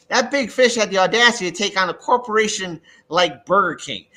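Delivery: ordinary delivery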